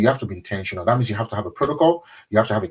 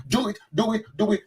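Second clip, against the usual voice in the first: mock frantic prayer; 10 semitones higher than usual. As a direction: imitate someone begging God frantically. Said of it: "They can bang their head".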